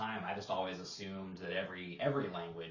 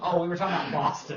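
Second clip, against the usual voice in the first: laughing through the line, fast and louder; 10 semitones higher, 12 dB louder, much faster than usual. Real, not acted. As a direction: blurt it out while still laughing.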